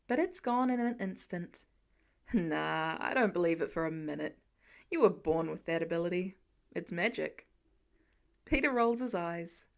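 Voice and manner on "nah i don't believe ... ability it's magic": Tony voiced. A narrator's reading. Tony teasing Peter about his gift, in a lower voice.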